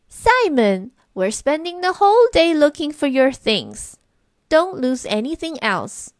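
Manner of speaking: scolding. A narrator's reading. Sister scolding Simon for losing things, scolding. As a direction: tell off a careless little brother. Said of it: "scolded Adele".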